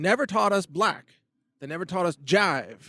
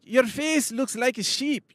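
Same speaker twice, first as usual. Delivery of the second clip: higher, drawn-out Irish caricature; Irish accent; 8 semitones higher, slower than usual. Shown as a demonstration. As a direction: leap into a slow, high-pitched comic Irish accent. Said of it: "You could go into Irish".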